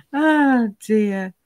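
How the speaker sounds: ordinary talk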